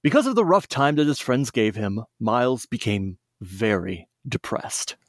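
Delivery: lively read-aloud storyteller voice; storybook narration. Acted. Friends on a podcast hear a fan story read aloud, lively storybook narration. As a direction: tell it like a bedtime story for kids.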